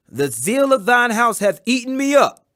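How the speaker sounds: ordinary delivery, nothing marked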